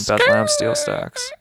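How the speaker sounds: suddenly remember the event; mock excited realization.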